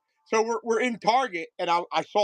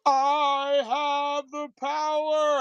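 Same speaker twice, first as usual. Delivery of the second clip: very slow, higher toy voice; toy sword voice; 7 semitones higher, much slower than usual. Retold or imitated. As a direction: stretch it out like a talking toy. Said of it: "It says".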